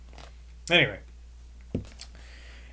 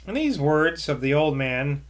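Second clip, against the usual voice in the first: ordinary delivery, nothing marked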